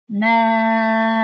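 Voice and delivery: ملیکی stretched far too long, past two counts; overextended vowel in ملیکی; wrong overlong ملیکی. Shown as a demonstration. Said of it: "این را ملیکی گفتن نادرست هست".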